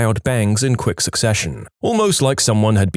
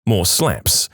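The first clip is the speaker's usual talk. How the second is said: plain reading voice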